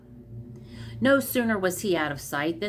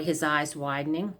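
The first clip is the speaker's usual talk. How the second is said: usual speaking voice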